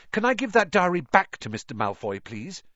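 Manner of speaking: quick, eager and rushed; hurried. Acted. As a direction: blurt it out fast. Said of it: "he said hurriedly".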